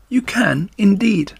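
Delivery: high, light chorus of little voices; tiny spiders. Acted. Spider daughters assuring Wilbur, in high little voices. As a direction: answer brightly like small spiders.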